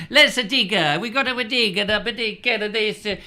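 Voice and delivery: high-pitched, hammed-up Italian accent; singsong stage Italian accent; mock Italian accent. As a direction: add vowels to word endings like a comic Italian. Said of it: "I can't get into an Italian accent".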